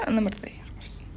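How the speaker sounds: whispered to herself, satisfied. Real, not acted. Gamer whispering to herself, content after the dance.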